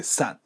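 "sat" from S glued onto at; linked S. Shown as a demonstration. Teacher shows liaison, "sat" as one syllable. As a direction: join shops' final S to at as "sat". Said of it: "これがくっついて".

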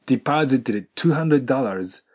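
slow, "deposited two" merged into one stop; linked d and t. Shown as a demonstration. Teacher demonstrating the linking, slowed down. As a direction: slowly blend the d of "deposited" into "two". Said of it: "ディパジットのDと、two hundredのtwo T".

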